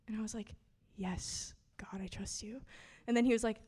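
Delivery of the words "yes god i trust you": soft unvoiced whisper, barely there; replying to God in a whisper; whispered answer. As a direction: whisper back your trust.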